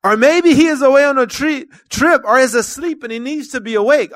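high, loud taunting; mocking. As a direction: keep jeering at them. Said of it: "Elijah began mocking them".

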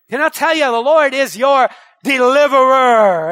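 loud, rising sermon delivery; preacher at full cry; full-force preaching. Acted. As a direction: drive it home to the congregation.

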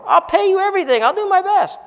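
high, loud pleading servant voice; begging servant. Retold or imitated. Preacher voicing the servant begging his master. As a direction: beg like a desperate debtor. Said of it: "what the servant begged for".

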